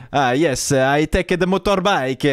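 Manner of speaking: put-on heavy Italian-accented English, slowed down; slow Italian accent, breaking into laughter; Valentino Rossi's Italian accent. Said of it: "l'accent italien de Rossi en anglais".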